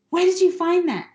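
frantic fan asking for her lost figure; frantic question. Retold or imitated. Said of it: "I ran to him".

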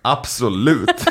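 drawn-out acceptance. Retold or imitated. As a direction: stretch the word out as you accept. Antar pojkens utmaning, återberättat. (utdraget, spelat självsäkert)

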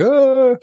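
a high, loud mock wail of a crying mum; mum's tearful cry on the phone, imitated. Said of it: "my mum's just, like, crying".

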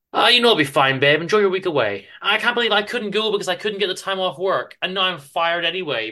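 husband cheerfully telling his wife he'll be fine; eager Chester. Acted. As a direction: play Chester brushing it off, keen and upbeat. Chester reassures his departing wife.

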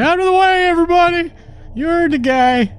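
high, loud yelling coachman; yelling driver. Acted. Driver cracking the whip, yelling at other carriages high and loud. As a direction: bellow at the traffic in a squeaky high voice.

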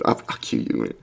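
imitating a hushed, breathy cue; low, barely voiced retold whisper. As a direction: whisper it as if cueing the band.

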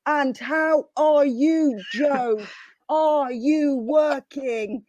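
very slow, over-careful talking-down voice; mock condescending relative speaking very slowly; patronisingly slow. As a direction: drag each word out as if to a foreigner. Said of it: "talk to him really slowly".

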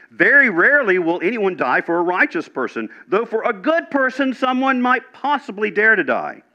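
high, ringing Bible reading; emphatic scripture reading. Real, not acted. Preacher reading Paul to the congregation, high and emphatic. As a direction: read the verse out high and insistent.

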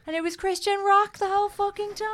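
high outraged fan voice; mock outrage. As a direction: play the betrayed fan, pitch up.

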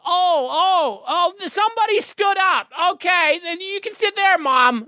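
drawn-out falsetto American fussing; American lady. Retold or imitated. American lady telling Mom about a freed seat, hammed-up high voice. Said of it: "the lady was like".

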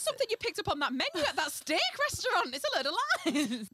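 loud, high incredulous protest; mock-outraged disbelief. Real, not acted. Challenging the co-host's made-up phrase, loud and incredulous. Incredulous banter at a misquoted saying.

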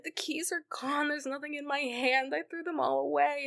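high, pleading retelling; acted distraught protest. Clara tells the Doctor the keys are gone, distraught.

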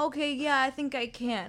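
put-on a small kid gathering courage; child telling parent it can try; hesitant child.

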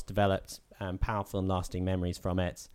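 ordinary delivery

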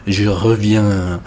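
"je reviens" said slowly in French, zhuh ruh-VYAN; drawn out French phrase. Shown as a demonstration. Host pointing out the novel's motto, slow French.

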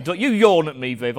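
mock telling-off. Preacher teasing Viv for yawning, mock scolding. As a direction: pretend to scold a friend.

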